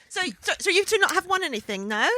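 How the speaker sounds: brazen call-out, high and quick; put-on high voice, pointedly asking; Jan. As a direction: imitate a nosy, stammering challenge. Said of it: "very nice of Jan to brazenly call out".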